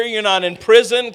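ordinary talk

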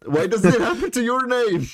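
high, teasing outrage; playful accusation. Real, not acted. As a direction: needle him, pitch jumping up. Mock accusation over Discord name.

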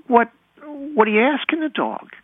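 put-on high-pitched, puzzled little kid; child's question. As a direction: voice a small child quizzing an older sibling. Child self questions brother, retold.

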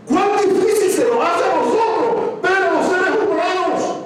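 high fervent preaching cry; impassioned cry. Real, not acted. Preacher lamenting to the congregation, fervent cry. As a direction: lament it at full force.

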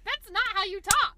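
loud, high complaining yell; exasperated yell. Retold or imitated. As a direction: protest it loudly. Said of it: "And I'm like".

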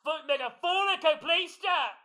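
high, loud mock coach yelling; imitated bullhorn scream. Imitating the coach screaming at a student driver. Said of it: "he would scream into the bullhorn".

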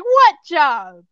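exasperated outburst; indignant demand. A narrator's reading. Acted quarrel between characters.

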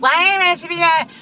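high, loud mocking mom impression; imitating mom. Daughter mimicking the mother who never listens, mocking. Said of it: "She's like".

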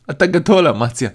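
cracking up at a friend's story; laughing. Acted. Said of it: "ירון שפוך מצחוק".

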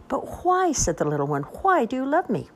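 high pleading little one; lightly voiced small child.

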